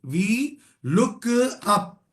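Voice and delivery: very slow, each syllable drawn out for students to copy; slowly spelled out. Shown as a demonstration. Teacher dictating a formula slowly to students. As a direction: dictate it slowly so they can type along. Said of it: "मैं धीरे धीरे बोलता हूं".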